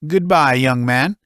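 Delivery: father seeing his son out with dignity; stiff old father. Acted.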